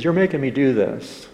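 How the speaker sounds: mild angry pushback, imitating a cornered man; angry protest. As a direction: protest it loudly, as retold. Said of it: "And he got angry".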